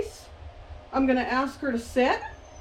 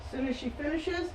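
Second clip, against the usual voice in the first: ordinary talk, nothing marked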